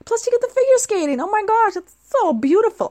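swooning high and excited over figure skating; gushing. Real, not acted. Vlogger to viewers, swooning over figure skating, high and thrilled. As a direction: gush like a starstruck fan.

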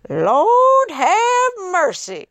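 very high, slow Southern lord have mercy; drawn-out high Southern Lord have mercy; Southern exclamation. Retold or imitated. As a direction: stretch it out like a shocked Southern clerk. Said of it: "And the guy said".

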